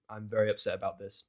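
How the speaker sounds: plain reading voice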